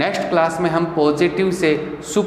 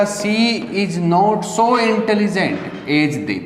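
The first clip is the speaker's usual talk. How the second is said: very slow, each word spaced; slow dictation; 4 semitones higher, much slower than usual. Shown as a demonstration. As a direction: read it out slowly for students to copy. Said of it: "teacher repeats slowly".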